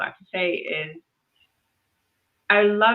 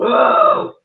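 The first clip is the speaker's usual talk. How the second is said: loud exasperated "ugh"; loud, exasperated grunt; frustrated groan; 9 dB louder than usual. Real, not acted. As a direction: let out a big groan of frustration. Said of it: "so frustrating".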